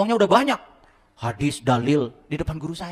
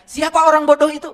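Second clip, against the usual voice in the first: much higher, louder replay of the challenge; loud question; 14 semitones higher, 10 dB louder than usual. Retold or imitated. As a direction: shout the question again at the crowd. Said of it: "Ketika saya tanya". (mengulang pertanyaan dengan lantang)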